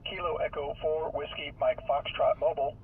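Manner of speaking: usual speaking voice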